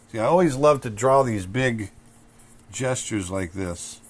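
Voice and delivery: ordinary talk, nothing marked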